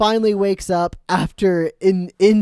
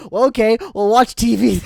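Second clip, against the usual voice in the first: loud, higher-pitched mom; hammed-up caving parent, voice raised; mock mom giving in; 6 semitones higher, 7 dB louder than usual. Acting out the permissive cartoon mom.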